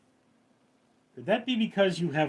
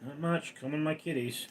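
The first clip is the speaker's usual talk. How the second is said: ordinary talk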